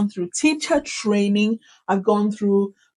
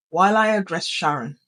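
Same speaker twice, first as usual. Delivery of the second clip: lower, icy monotone; sudden robot tone; 4 semitones lower than usual. Shown as a demonstration. Teacher demonstrating the tone for addressing Sharon, cold monotone. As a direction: drop the pitch and level it out. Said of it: "it just suddenly takes that tone".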